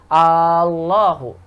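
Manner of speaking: "Allahu" slowly with the first A held; long opening a. Shown as a demonstration. Teacher showing a question form, "Allahu" with drawn-out A. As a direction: stretch the initial vowel of "Allahu". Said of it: "umat panjangkan lafaz Allahnya".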